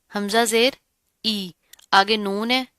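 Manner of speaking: ordinary talk, nothing marked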